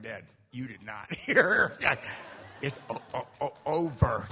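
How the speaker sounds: slow, loud, laughing and stammering; laughing disbelief. Retold or imitated. Son telling his dad it's over while laughing loudly. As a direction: break into laughter mid-word.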